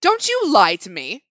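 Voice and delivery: loud, slow furious scolding. Acted. Woman furiously accuses the kneeling narrator, loud. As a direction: belt it out at her. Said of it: "belted out the words furiously".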